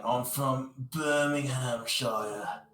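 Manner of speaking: slow, drawn-out fake British accent; shitty fake UK accent. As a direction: stretch it out in a bad British accent. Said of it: "shitty, fake, like, you know, U K accent".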